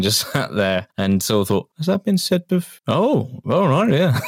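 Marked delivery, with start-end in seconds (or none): 1.8-4.2 surprised fan. Retold or imitated. Fans reacting in disbelief.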